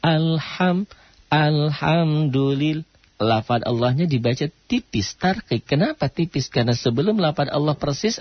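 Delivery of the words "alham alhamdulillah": "Alham" released promptly, then slow, higher "alhamdulillah"; mim not held. Shown as a demonstration. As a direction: recite "alhamdulillah" carefully, without lingering on the m. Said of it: "Jangan ditahan".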